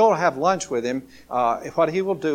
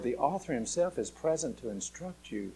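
normal talking voice

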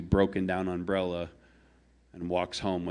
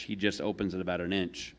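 usual speaking voice

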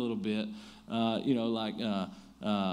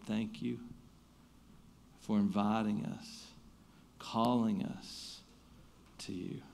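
soft, lower, unhurried prayer voice; 5 semitones lower, 5 dB quieter than usual. Acted. Pastor leading the church in prayer, soft and reverent. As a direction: pray quietly and reverently.

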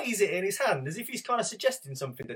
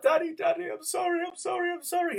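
much higher mock sobbing little boy; crying toddler; 14 semitones higher than usual. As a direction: wail sorry like a weeping child. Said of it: "tears are pouring down his cheek".

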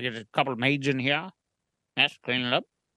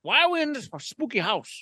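lightly voiced grizzled miner; a hint of an old prospector; gruff mountain man; 6 semitones higher than usual. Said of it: "same line repeated as Prospector".

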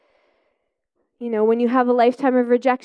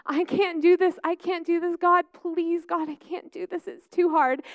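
mock desperate plea, higher; a retold begging cry to God, pitched up; 8 semitones higher than usual. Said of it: "I was crying out to God".

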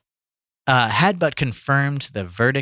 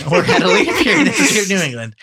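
reading through laughter, higher and fast; laughing; 7 semitones higher, 5 dB louder, faster than usual. Real, not acted. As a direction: try to read while cracking up.

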